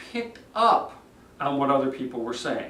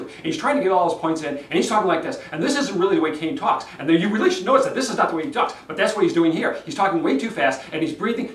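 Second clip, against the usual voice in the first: fast and louder, gasping between phrases; rushed breathless talk; 3 semitones higher, 4 dB louder, faster than usual. Shown as a demonstration. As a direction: hurry through it short of breath. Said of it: "Notice how I'm breathing?".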